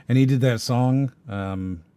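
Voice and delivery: ordinary talk, nothing marked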